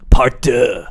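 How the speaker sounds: loud, punchy trailer announcer; movie trailer voice. Retold or imitated. Recalling the Tropic Thunder trailer, loud announcer voice.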